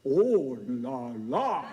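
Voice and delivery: slow, drawn-out French "Oh la la"; French old man. Retold or imitated. Old Frenchman seeing the drenched speaker, slow amused "Oh la la". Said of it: "looks at me and goes".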